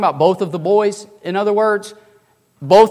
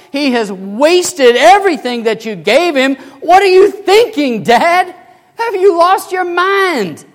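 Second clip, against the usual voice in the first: high, loud, slow indignant outburst; indignant son; 8 semitones higher, 6 dB louder, slower than usual. Acted. Older brother protesting to his father: high, loud indignation. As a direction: rail at the father, voice climbing high.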